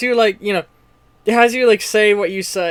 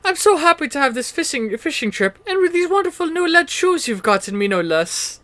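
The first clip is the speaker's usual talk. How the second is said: very high, gleeful little-son voice; young boy; 10 semitones higher than usual. Acted. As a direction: gush innocently to father in a high pitch. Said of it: "the young boy who".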